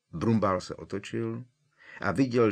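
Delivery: ordinary delivery, nothing marked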